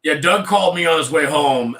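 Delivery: usual speaking voice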